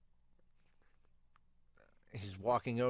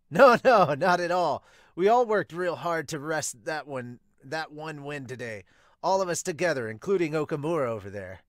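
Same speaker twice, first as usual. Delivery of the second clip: put-on high, loud eager boy voice; loud cheerful kid bursting into the room; excitable Sawamura; 4 semitones higher, 10 dB louder than usual. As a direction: burst in bright and keen as the hyper rookie. Sawamura modestly deflects praise.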